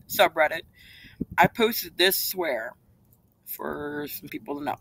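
ordinary delivery

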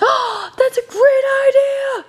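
light up at a brilliant idea; squealing with sudden excitement; excited gasp. Real, not acted. Sudden idea while playing with dolls.

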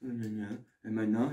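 low, very soft, slow mumbling; listless mumble. Retold or imitated. Vlogger mimicking his lifeless video, quiet mumble. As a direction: imitate yourself too limp to speak up. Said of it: "j'avais pas envie de parler fort".

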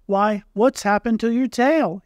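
a sudden lift of surprise at the missing tail; surprised. A narrator's reading. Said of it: "he said in surprise".

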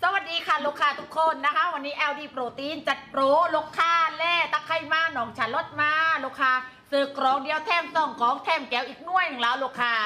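Southern Thai sales pitch; live-selling patter with Southern Thai tones; Southern Thai dialect. Shown as a demonstration. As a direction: greet buyers in a Southern Thai accent. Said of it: "เดี๋ยว[name]พูดใต้นะ".

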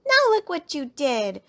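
high, loud accusation; cross child shouting blame; angry shout. A narrator's reading. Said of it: "shouted Lizzie".

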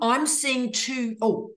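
normal talking voice

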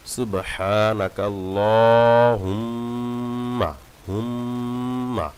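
হুম্মা with a held nasal on the first mim; slow, ringing হুম্মা; ghunna demo. Shown as a demonstration. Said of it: "প্রথম মিমকে গুণনা করতে হবে". (মিমে গুণনা করে পড়া)

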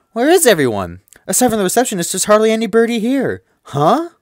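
high, loud student voice; chipper heroine. Acted. Heroine heading to the library at lunch, lively high voice. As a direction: play a lively schoolgirl thinking aloud.

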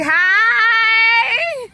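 loud, high, happy greeting shout; excited holler. Real, not acted. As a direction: holler hello with joy. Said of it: "I'm sorry for the hollering".

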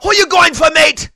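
high, rowdy fake Australian accent; attempted Australian accent. Irish fan confronting him in the casino, mock Australian accent. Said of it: "that's an Australian accent".

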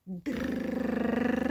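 дра with a rolled р; syllable dra. Shown as a demonstration. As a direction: say дра, rolling the р. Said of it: "наприклад". (дра з розкотистим р)

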